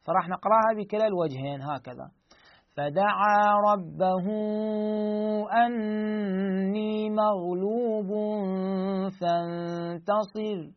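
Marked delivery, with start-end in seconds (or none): 2.8-10.7 slow Quranic chant, pausing on فانتصر with the final ر silent; tajweed reading that halts on فانتصر; recitation, stopping. Shown as a demonstration. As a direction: recite slowly and stop on فانتصر, ending on a plain silent ر. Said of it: "هاي بالوقف".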